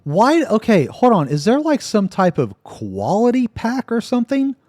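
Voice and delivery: high-pitched, agitated disbelief; incredulous. Real, not acted. Friend questions why the pictures differ, incredulous. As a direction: demand an explanation, voice way up high.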